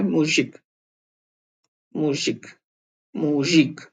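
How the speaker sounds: मुजिक said slowly as "moo-zik", repeated; teacher models मुजिक syllable by syllable; model pronunciation. Shown as a demonstration. Russian teacher repeats मुजिक for Hindi learners. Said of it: "माफ कीजिए मैंने गलत लिखा है".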